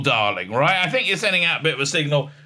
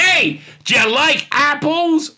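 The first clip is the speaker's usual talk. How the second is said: slow, high, goading question; imitated movie swagger, drawn out; cocky taunt; 9 semitones higher, 4 dB louder, slower than usual. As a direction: call it out to taunt a rival. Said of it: "goes up to him".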